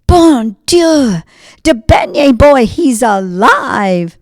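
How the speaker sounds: high, loud gasp of wonder; astonished cry. Acted. Marcel sees the beignet boy move, loud astonished cry. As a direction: baker can't believe his eyes.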